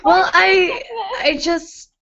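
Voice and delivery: high, halting acted stammer; high-pitched tongue-tied hesitation; lost for words. A narrator's reading. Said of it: "tried to find his words but failed miserably".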